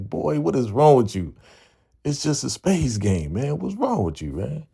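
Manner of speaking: high-pitched imitation of teasing disbelief; mocking incredulity. [name] laughing at nervous Damien over spades, mocking incredulity. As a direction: needle him in a high, exasperated mock voice.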